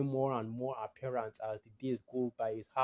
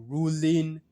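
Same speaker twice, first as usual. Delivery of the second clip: RULING drawn out and louder; stress on RULING; 5 semitones higher, 10 dB louder than usual. Real, not acted. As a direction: lean hard on "ruling", stretching it. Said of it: "emphasis on the ruling".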